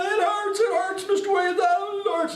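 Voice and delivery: very high-pitched mock wail of pain; wailing hurt boy. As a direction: imitate a hurt kid crying out, high and strained. Injured boy wailing in pain, retold.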